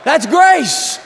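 high, loud proclaiming shout; preaching shout. Real, not acted. Preacher driving home grace to the congregation, shouting. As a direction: proclaim it to the room.

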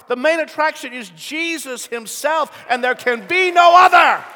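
high and loud, building to a cry; preaching at full force. Acted. Pastor driving home the point to the church, preaching hard. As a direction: preach it with rising fire.

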